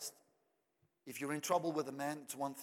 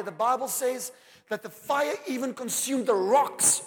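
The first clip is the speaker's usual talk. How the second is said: higher and louder, building fervor; preaching at full force; 9 semitones higher, 10 dB louder than usual. Acted. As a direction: preach it up, rising to the peak.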